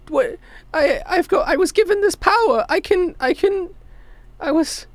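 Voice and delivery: put-on high-pitched stammering kid; small kid struggling to explain; flustered child. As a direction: stumble through it in a high child's voice.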